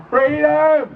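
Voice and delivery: high, drawn-out cry to the crowd; rallying shout. Real, not acted. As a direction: call it out to start a chant. Leads rally crowd in chant.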